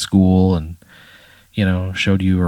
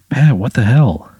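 surprised; 4 semitones higher, 5 dB louder than usual. Acted. Dad surprised seeing the bike.

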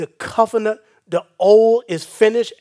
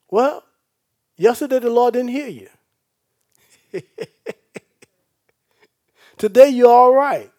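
loud, high shouting sermon; fiery preaching; 5 semitones higher, 8 dB louder than usual. Acted. Preacher telling the church God always hears, loud and fiery. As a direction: lift it up and hammer it home.

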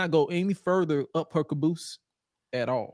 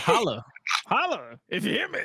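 wrestler promo; 6 semitones higher than usual. Retold or imitated. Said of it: "So did he say".

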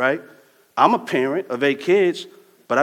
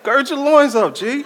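mock rough summons; 10 semitones higher, 5 dB louder than usual. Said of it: "I'm not gonna do him like that".

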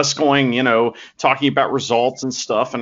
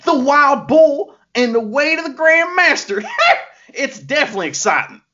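put-on Southern accent, lightly done; a hint of a put-on American South accent; Master Wato Southern accent. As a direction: play a folksy Southern good-guy wrestler. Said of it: "he's putting on a voice".

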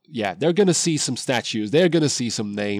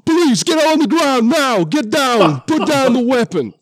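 high, very loud shouted commands; urgent full-force yell; police shouting orders; 10 dB louder than usual. Acted. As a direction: shout it like an officer confronting an armed suspect. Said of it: "starts shouting at them".

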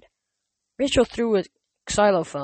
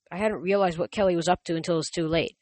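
ordinary delivery

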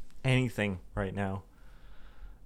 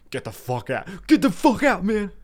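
much higher, much louder frantic yell; panicked inner yell; 9 semitones higher, 9 dB louder than usual. Retold or imitated. His panicking brain shouting to escape, frantic yell. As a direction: scream it like your brain is fleeing. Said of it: "my brain isn't letting me".

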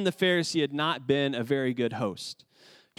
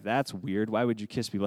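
lower, softer mock disbelief; mock puzzled American; 5 semitones lower, 3 dB quieter than usual. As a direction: play the baffled American, half-joking. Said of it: "we're like".

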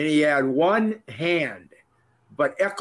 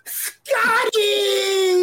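big excited yell; yelling his name. Retold or imitated. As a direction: belt out his name with joy. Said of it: "I yell out".

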